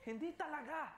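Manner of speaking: high burst of laughter through the words; laughing. Real, not acted. Laughs at his own complaint.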